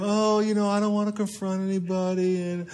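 soft, hesitant mumble; a hint of a timid pushover; timid whiny voice. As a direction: spineless little mumble. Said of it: "this little spirit of".

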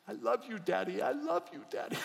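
high, tender voicing of a young girl; high tender daughter voice; daughter's tender words. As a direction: coo it like a daughter hugging her dad. Daughter voiced hugging weeping dad.

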